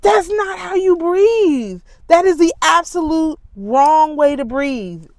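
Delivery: incredulous protest; outraged. Real, not acted.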